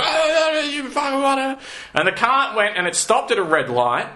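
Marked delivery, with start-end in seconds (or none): 0.0-1.6 high-pitched garbled yelling, imitating a heckler; mock shouted abuse. Man leaning out a car window at passers-by, mock shouting abuse. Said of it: "shouting abuse at everyone on the street".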